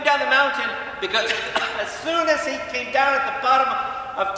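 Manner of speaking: loud, high, impassioned sermon delivery; booming pulpit voice; fiery preaching. Acted. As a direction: preach it out with full force. Preaching at full force opening.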